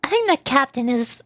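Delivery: ordinary talk, nothing marked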